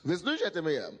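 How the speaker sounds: ordinary talk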